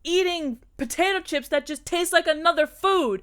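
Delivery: high, loud mock tirade; mock furious rant. Ranting at Cameron about bath sheets and chips, loud mock fury. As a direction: unload on him, hammed-up and loud. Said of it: "I'd be like".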